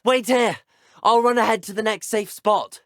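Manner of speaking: loud, high urgent call ahead. Acted. Student calling back to companion before running ahead, loud urgent shout. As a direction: shout it so they hear you over the chaos.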